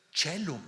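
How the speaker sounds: "chelum" opening with CH as in church; CH pronunciation. Shown as a demonstration. Teacher offers students a third option for Latin, "chelum" with CH. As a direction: say "chelum" as CHE-lum. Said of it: "Como C H".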